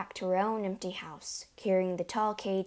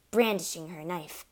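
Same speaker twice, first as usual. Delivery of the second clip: ordinary delivery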